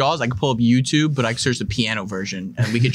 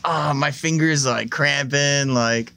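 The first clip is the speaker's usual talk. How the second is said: fake excuse; slower than usual. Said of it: "I was like".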